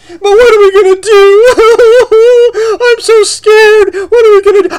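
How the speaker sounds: high, slow, whiny mock fear; mock scared whimper. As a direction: sneer at cowards by playing them.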